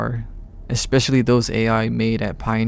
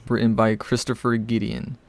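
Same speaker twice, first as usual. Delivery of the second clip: usual speaking voice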